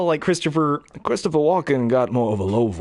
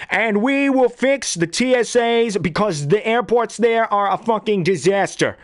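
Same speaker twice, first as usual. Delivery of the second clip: pompous campaign promise; Trump impression; 9 semitones higher than usual. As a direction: Trump voice ranting about airports. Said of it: "Yeah, Trump".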